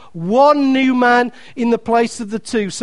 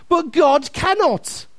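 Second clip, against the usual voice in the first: normal talking voice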